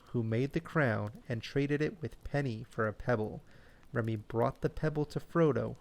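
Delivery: usual speaking voice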